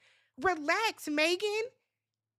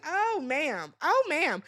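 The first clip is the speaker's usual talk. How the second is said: sassy scolding; 3 semitones higher, slower than usual. Acted. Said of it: "Your attitude is very stank".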